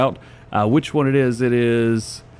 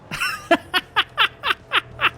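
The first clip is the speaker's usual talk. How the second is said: high giggle. Real, not acted. Reacts to the tractor feature.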